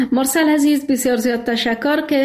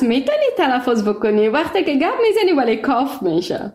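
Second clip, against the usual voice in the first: usual speaking voice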